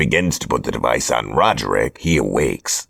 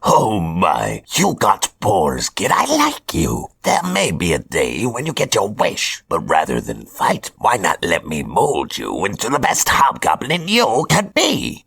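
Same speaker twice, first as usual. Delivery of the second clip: higher, theatrical villain voice; Hobgoblin voice; 8 semitones higher than usual. Acted. Hobgoblin announces his chosen apprentices, smug villain voice. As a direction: play a smug supervillain handing out orders.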